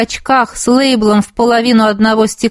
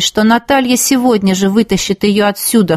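ordinary delivery, nothing marked

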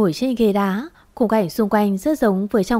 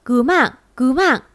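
higher, urgent call for rescue; straining cry for help; 4 semitones higher than usual. A narrator's reading. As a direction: cry out for help, without a full scream. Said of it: "tôi cố gào lên". (gắng kêu cứu, giọng cao gấp gáp)

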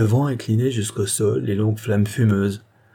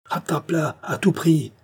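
urgent cry; 7 semitones higher than usual. A narrator's reading. Maxime to his coachman amid the thunder, urgent high cry. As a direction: cry the order out over the storm. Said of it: "cria Maxime, au milieu du fracas du tonnerre".

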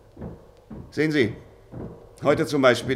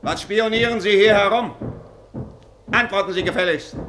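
angry demand; 7 semitones higher, 4 dB louder than usual. Acted. Offizier stellt die Besucher zur Rede.

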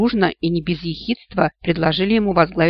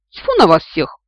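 loud scornful scoff; contemptuous snort; 6 dB louder than usual. Acted. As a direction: spit it out with contempt. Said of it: "презрительно фыркнула я".

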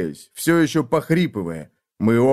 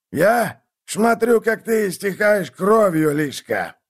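put-on slow, higher, smug old voice; gloating old man; 8 semitones higher, slower than usual. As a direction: savour watching him bleed.

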